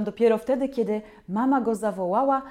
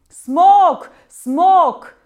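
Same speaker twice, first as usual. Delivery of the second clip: loud frantic shouts; crowd yelling; 9 semitones higher, 14 dB louder than usual. A narrator's reading. Villagers yelling at the monster, loud shouts. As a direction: yell it like an angry mob.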